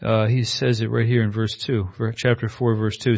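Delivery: ordinary talk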